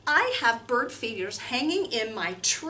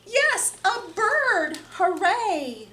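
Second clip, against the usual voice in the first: high, louder cheer of delight; excited; 7 semitones higher, 3 dB louder, slower than usual. Acted. Telling children they are a bird, excited cheer. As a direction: cheer it up for the kids.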